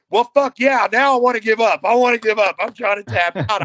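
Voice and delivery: loud, high-pitched pleading; comically frantic yelling; retold desperate surrender. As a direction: act out begging to quit a painful hold. Acts desperate to give up.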